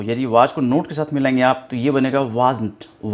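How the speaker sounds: normal talking voice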